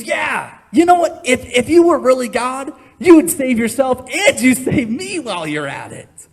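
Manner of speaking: loud, high mocking yell; jeering shout. Preacher voicing the mocking criminal on the cross, jeering shout. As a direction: yell the taunt up at him. Criminal's taunt voiced in sermon.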